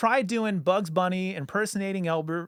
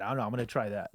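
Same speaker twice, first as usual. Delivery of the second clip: lower, softer nervous impression; Don Knotts impression; 6 semitones lower, 6 dB quieter, faster than usual. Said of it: "the line Don Knotts always said".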